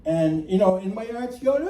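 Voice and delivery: ordinary talk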